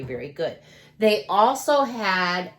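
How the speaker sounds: ordinary talk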